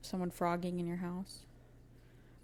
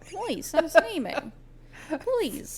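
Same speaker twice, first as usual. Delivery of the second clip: high, pleading whine; mock begging; 9 semitones higher, 4 dB louder than usual. Cohost pleads with listeners for emails. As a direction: beg them, high and needy.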